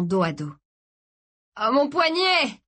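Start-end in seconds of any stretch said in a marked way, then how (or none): 1.6-2.6 high, drawn-out pained cry; cri de douleur. A narrator's reading. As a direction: cry out in pain, pitched well up. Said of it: "cria Gray". (crié de douleur)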